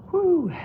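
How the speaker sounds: sharp high whew of exhaustion; breathless whew. Real, not acted. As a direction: blow out air after the set. Winded mid-workout exhale.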